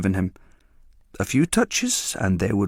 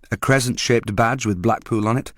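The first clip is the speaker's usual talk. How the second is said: usual speaking voice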